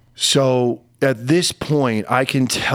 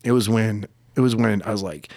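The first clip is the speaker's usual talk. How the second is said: ordinary talk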